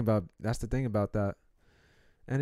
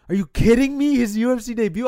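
loud, much higher incredulous outburst; disbelief; 12 semitones higher, 11 dB louder than usual. Retold or imitated. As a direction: shout it like you can't believe the matchup. Disbelief at UFC fighter matchup.

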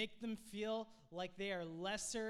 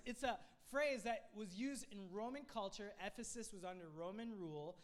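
ordinary delivery

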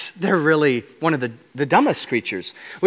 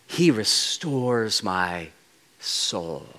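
hushed reverent reading; 7 semitones lower, 7 dB quieter, much slower than usual. Acted. Slow reverent quote.

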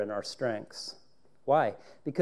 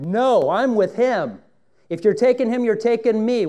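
bold declaration; 7 semitones higher, 9 dB louder than usual. Retold or imitated.

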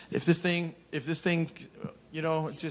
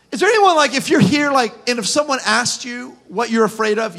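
ordinary talk

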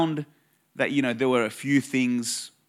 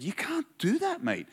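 high-pitched, softer retold protest; unsettled objection; 10 semitones higher, 7 dB quieter than usual. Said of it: "this kind of unsettled me a bit".

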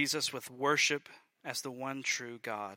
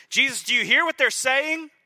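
high, loud outraged challenge; hammed-up angry scribe; indignant priests; 12 semitones higher, 11 dB louder than usual. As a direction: demand it like scandalized priests. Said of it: "They're angry. They're frustrated with Jesus".

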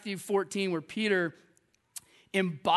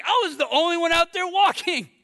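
indignant retort; 9 semitones higher, 6 dB louder than usual. Acted. Peter retorting to his mockers.

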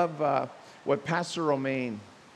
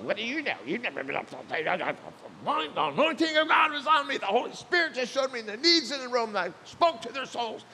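high, huffy mock rant; mock indignant husband; 8 semitones higher, faster than usual. Pastor ranting to his wife on the drive home, high and huffy. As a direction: play the wounded pastor defending himself.

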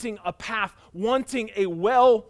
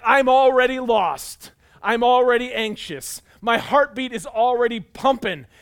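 louder, higher, mounting stress; anxious outburst; 5 semitones higher, 8 dB louder than usual. Acted. Preacher voices the lost listener's anxious thoughts, loud and high. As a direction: act out someone overwhelmed, loudly.

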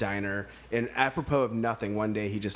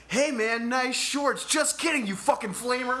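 high-pitched heckle called from a passing car; mock drive-by yell; 13 semitones higher than usual. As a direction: holler it out like a jeering stranger. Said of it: "yelled, all completely".

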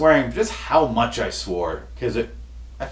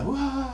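thin, high-pitched "wah"; high soft wail; 13 semitones higher, 7 dB quieter than usual. Acted. Host breaking off his sign-off, high soft wail. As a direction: let out a quiet, high mock wail. Imitated noise while joking.

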